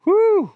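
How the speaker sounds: loud, high-pitched "whew"; high whoop. Real, not acted. Resetting after failed joke.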